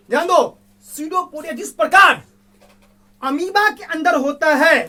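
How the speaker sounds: emphatic. Real, not acted. Teacher calls class to attention.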